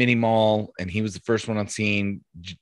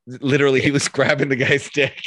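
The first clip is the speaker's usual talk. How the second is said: talking through laughter, pitched up; laughing; 5 semitones higher than usual. Real, not acted. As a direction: laugh while telling the punchline. Laughing at own story.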